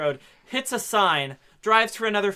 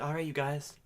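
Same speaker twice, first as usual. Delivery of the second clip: hushed mock healer voice; 7 dB quieter than usual. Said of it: "he's like".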